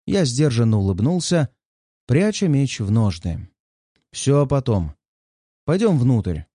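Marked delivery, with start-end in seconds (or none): 4.1-6.4 curt. Acted. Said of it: "коротко бросил я".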